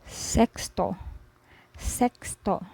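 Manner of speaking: sexto with a clear ks; Spanish word. Shown as a demonstration. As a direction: say sexto as SEKS-toh, careful. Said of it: "so for example".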